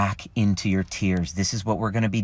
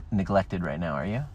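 usual speaking voice

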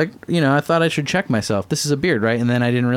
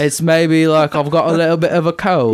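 stuffed-up British accent, as if with a cold; amateur congested British accent; 3 semitones higher than usual. Shown as a demonstration. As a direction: put on British with a head cold. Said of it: "Yeah, you do have a head cold".

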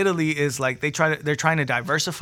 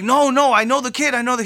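high, loud insistent protest; desperate protest; 9 semitones higher, 6 dB louder than usual. Retold or imitated. Leader stopped at the lake's edge, desperate protest. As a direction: push back urgently, voice jumping up. Arguing to get to drowning kid.

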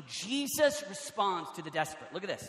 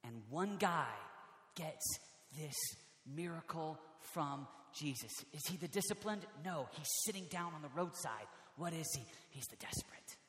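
hushed preaching; 6 semitones lower, 15 dB quieter than usual. Real, not acted. Preacher revealing who gets the miracle, hushed. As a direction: draw them in, almost whispering by the end.

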